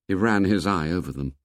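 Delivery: plain reading voice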